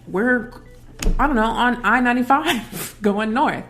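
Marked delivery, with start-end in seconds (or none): none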